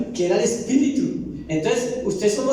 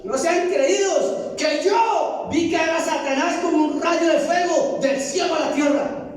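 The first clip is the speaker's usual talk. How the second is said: higher, slow, solemn rebuke; a lightly voiced Jesus scolding; Jesus rebuking; 9 semitones higher, slower than usual. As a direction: slowly chide the proud disciples. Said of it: "se comió Dios".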